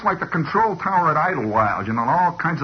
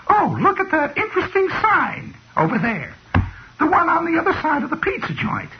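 high, put-on wifely chirp; mock a wife pretending great interest, pitched way up; wife feigning delight; 12 semitones higher than usual. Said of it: "she is pretending she's having a great time".

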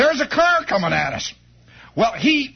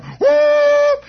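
sudden loud whoop, far higher, like a train whistle; 7 dB louder than usual. Retold or imitated. Interurban train coming, loud high whistle whoop. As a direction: blast it out like a train horn.

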